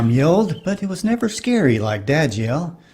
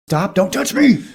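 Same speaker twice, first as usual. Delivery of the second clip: higher-pitched imitated childhood yell; kid yelling; 7 semitones higher than usual. Boy to sister who keeps pecking him, kid yelling. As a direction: yell it like a pestered brother. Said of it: "I'd yell".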